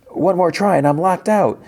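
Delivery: mock freaking out. Solo down to his last try, mock freaking out. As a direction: imitate his panic in a high pitch. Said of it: "he's, like, freaking out".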